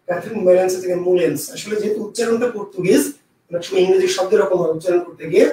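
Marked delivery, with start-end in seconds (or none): none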